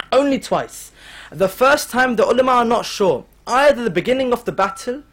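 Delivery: normal talking voice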